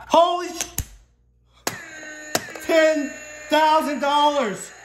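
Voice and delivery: very high, loud, drawn-out yells of disbelief; ecstatic shouting. Real, not acted. Scratch-off player yelling to viewers over a ten thousand win, ecstatic shout. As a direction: scream it like you just won big.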